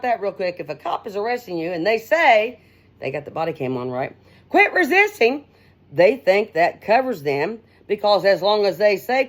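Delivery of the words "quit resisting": higher, louder mock police command; imitating a cop at an arrest, higher and louder; barking cop order. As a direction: bark it like an arresting officer.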